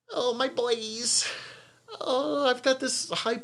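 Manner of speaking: high, drawn-out mock annoying voice; slow, high-pitched, half-given-up imitation; Miranda Richardson. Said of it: "do her high-pitched, annoying voice".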